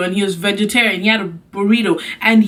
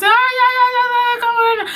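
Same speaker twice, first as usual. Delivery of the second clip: friend's urgent call; 13 semitones higher than usual. Retold or imitated. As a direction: fake panic from the friend on the phone. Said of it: "she was like".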